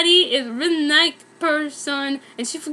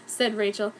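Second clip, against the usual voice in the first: ordinary delivery, nothing marked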